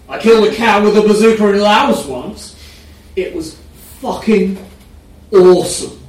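loud, high boasting brag. A narrator's reading. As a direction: shout it out proudly. Oliver's boastful loud quote.

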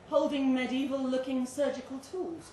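ordinary delivery

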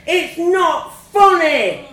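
put-on high, loud, drawn-out child's scream. A narrator's reading. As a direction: scream it slowly in outrage. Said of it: "I screamed".

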